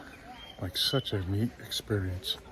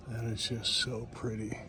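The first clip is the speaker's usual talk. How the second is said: hushed admiring; 6 dB quieter than usual. Real, not acted. Admiring hidden flower.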